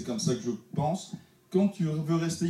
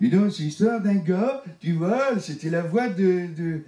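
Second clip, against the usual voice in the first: higher, louder comic storyteller voice; Jean-Marie Bigard; 6 semitones higher, 4 dB louder than usual. Retold or imitated. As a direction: tell it like a booming French comic. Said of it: "je faisais Jean-Marie Bigard".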